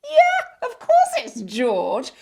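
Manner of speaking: a high, loud burst of delight with a laugh; excited. Real, not acted. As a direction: cheer as you recognise him. Excited recognition of the boy.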